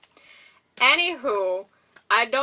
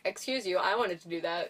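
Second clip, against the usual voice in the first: quiet, affronted Darcy; offended; 4 semitones lower, 8 dB quieter than usual. Retold or imitated. Darcy put out that Jane slapped Loki first, quiet and affronted. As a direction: softly put out, like she missed her chance. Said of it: "she'll be, like, offended".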